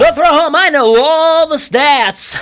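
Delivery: high, slow drawled country announcer; a pretend ballpark announcer boasting in a mock southern accent; hammed-up southern announcer. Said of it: "stop with this fucking accent".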